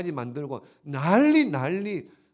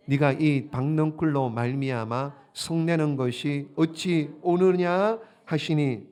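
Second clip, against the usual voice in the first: scripture reading; 5 dB louder than usual. Acted. Reads scripture aloud.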